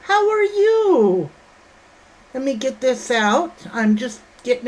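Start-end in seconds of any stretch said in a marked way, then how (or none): 0.0-1.3 high, slow, drawn-out hello-song; sing-song greeting. Real, not acted. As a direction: croon the question up high like a cheerful greeting.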